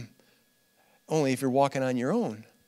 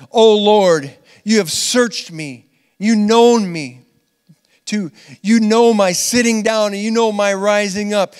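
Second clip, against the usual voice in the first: loud, raised, declamatory psalm reading; scripture reading; 4 semitones higher, 6 dB louder than usual. Real, not acted. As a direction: proclaim the verses to the congregation, measured and forceful. Reading a psalm aloud to congregation.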